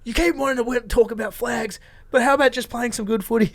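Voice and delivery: high, put-on voice of a fed-up teammate; annoyed teammate. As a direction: lecture them like the grumpy guy quitting. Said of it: "he was like".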